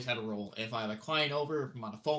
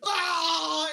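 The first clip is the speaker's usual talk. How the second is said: high, loud wailing scream; imitated panicked shriek; 7 dB louder than usual. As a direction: scream as if fleeing in pain. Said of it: "running downstairs screaming".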